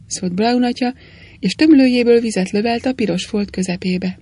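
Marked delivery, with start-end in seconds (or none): none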